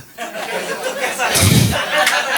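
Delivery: airy, high burst of laughter; the host laughing at his own overtime quip; breathless laugh. Real, not acted. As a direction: laugh off the joke you just made.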